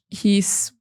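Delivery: normal talking voice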